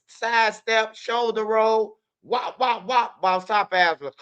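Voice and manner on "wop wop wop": punch sounds. Retold or imitated. Imitating punch sounds in fight story.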